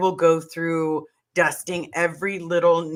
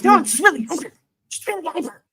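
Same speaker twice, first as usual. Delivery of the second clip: much higher, bouncy insistence; hyper reply; 9 semitones higher, faster than usual. Retold or imitated. Friend answers in a high bouncy hyper voice. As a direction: insist brightly, wired. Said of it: "And she goes".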